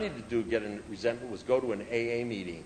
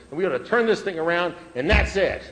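louder, pushing to end the case; firm, raised closing demand; insistent demand; 5 semitones higher, 6 dB louder than usual. Retold or imitated. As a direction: lay down the final word. His closing demand to sponsor.

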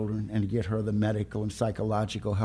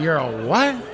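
high, loud, laughing disbelief; stunned laugh of a husband hearing the news; shocked laughing question; 5 semitones higher, 5 dB louder, slower than usual. Retold or imitated. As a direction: ask it like you can't believe your ears. Retelling his shocked reply.

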